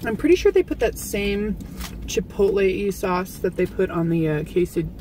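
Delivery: chewing while talking; talking between bites; mouth partly full. Real, not acted. Seen 2: woman holds taco near mouth, just bit, jaw chewing while talking.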